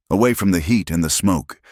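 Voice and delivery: ordinary delivery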